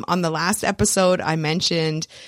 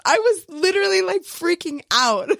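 higher, laughing through the words; excited laughing; 10 semitones higher than usual. Real, not acted. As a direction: gush while giggling.

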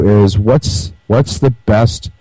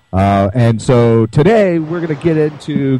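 ordinary delivery

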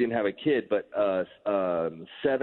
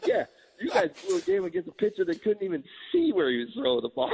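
talking through real laughter; gleeful teasing, laughing higher; 11 semitones higher than usual. Real, not acted. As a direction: rub it in while cracking up. Teasing friend about lost game.

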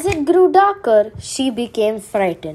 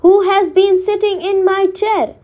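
higher-pitched mother bear, grumbling; a mildly grumbling mother bear voice, pitched up; 7 semitones higher than usual. A narrator's reading. Mother Bear finds her porridge touched, higher grumbling voice. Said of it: "Mother Bear grumbled".

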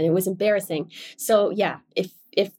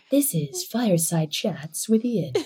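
slow, slightly lowered mock radio host; imitated host voice; 3 semitones lower, slower than usual. Co-host teasing the host about his intro, imitated low host voice. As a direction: tease with a drawn-out, sultry podcast intro voice. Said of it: "like, a little bit lower".

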